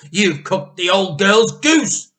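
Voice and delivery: loud, slow, accusing; angry scolding. Acted. Wife blaming her husband for the goose, loud and slow. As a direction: hurl the blame at him.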